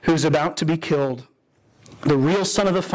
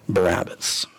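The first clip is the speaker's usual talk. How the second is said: dramatic whisper; 10 semitones lower than usual. Acted. Dramatic release of Barabbas.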